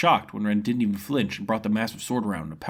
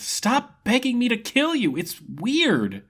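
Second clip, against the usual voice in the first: high, slow, whiny protest; cheerful fighter fed up with the drama; exasperated young hero; 14 semitones higher, 4 dB louder, much slower than usual. Acted. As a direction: plead with him to drop it. Ren exasperated.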